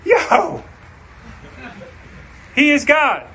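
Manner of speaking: high, excited cry of awe; sudden high shout of wonder; amazed crowd. Retold or imitated. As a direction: blurt it out like a stunned crowd. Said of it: "all the people are like".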